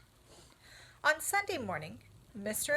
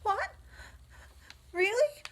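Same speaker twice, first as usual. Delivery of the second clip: much higher, louder; surprised cry; 13 semitones higher, 6 dB louder than usual. Acted. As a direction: cry out in hope. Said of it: "cried Wilbur".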